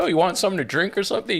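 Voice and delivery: quick, high-pitched offer; retold casual host. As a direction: toss out a rapid mimicked invitation. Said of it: "and then you're like".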